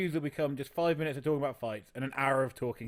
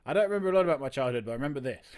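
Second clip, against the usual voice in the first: ordinary talk, nothing marked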